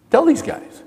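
impassioned call to encourage; fervent urging. Real, not acted. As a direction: lift it up and plead with the men. Urging fathers to encourage them.

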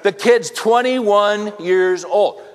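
"twenty-one" drawn out slowly and pressed; very slow, deliberate emphasis; emphatic. Real, not acted. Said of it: "Are you guys getting this?".

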